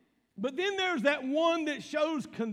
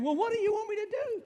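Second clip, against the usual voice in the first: higher, quick, mock-exasperated wife; 8 semitones higher, faster than usual. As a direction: toss it off like a fed-up wife. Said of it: "it's kinda like your wife saying".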